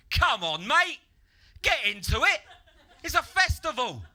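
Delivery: loud, high-pitched goading shout; festival ringleader. Acted. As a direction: shout it like a pushy raver rallying someone. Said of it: "the ringleader came over and he went".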